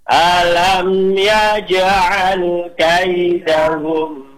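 slow melodic tartil chant; teacher modelling tajwid recitation; Quran recitation. Shown as a demonstration. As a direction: recite it measured and sung. Group recitation.

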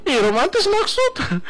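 mock scoffing. Husband scoffing at wife's wish for romance, slow and teasing. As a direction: stretch it like a playful scoff.

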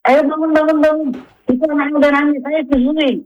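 high-pitched, insistent mock wake-up call; imitated unpleasant nagging. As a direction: nag a sleeping mother to get up. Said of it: "nada yang tidak nyaman menurut ibu". (menirukan nada mendesak yang tidak enak)

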